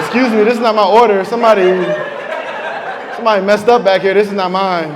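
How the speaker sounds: loud, fast mock complaint at a counter. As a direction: protest loudly like a customer sent the wrong order. Complaining like a wrong fast-food order.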